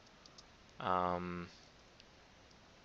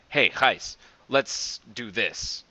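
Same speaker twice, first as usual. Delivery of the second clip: louder, slowing into a firm directive; direct instruction; 7 dB louder, slower than usual. Pretend. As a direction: tell a colleague plainly what to do. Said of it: "instead of telling him to do something".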